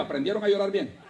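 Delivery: usual speaking voice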